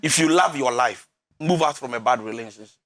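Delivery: low, weighty emphasis on "love" and "move out"; grave, emphatic plea stressing "love". Real, not acted. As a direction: press "love", then drop low on "move out". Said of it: "Let me say this emphatically".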